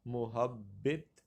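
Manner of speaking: محبت slowly as "mu-hab-bit", u first, i last; pronunciation option. Shown as a demonstration. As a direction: say محبت drawn apart, ending in "bit". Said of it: "غلط پڑھا جاتا ہے".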